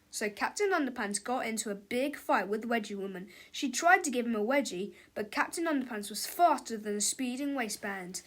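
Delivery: plain reading voice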